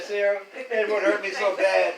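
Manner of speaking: slow, loud and laughing through it; laughing while quoting. Retold or imitated. Retelling the neighbour's hurt, laughing. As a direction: quote her while cracking up.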